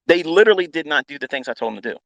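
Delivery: normal talking voice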